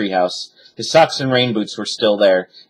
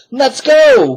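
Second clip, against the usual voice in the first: much higher, loud urgent call; cried; 7 dB louder than usual. A narrator's reading. Girl urges her brother to flee, loud cry. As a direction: urge brother to hurry. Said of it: "cried Annie".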